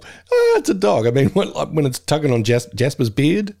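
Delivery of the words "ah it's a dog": high, mock-delighted coo; delighted residents. Retirement village residents meeting a dog, delighted. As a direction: imitate melting at a dog, pitch way up. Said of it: "the residents of the retirement village getting all".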